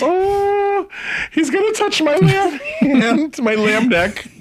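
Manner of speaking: high-pitched hammed-up panic, breaking into laughter; mock nervous. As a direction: pretend to fret in a squeaky high voice. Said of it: "Was he nervous?".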